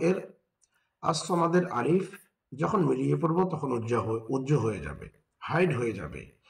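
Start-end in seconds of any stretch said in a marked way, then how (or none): none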